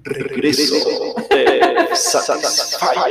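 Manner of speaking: slow dramatic echoing announcer; delayed self echo. Pretend. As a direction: drag it out with echo. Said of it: "podemos usar la voz de Misterio".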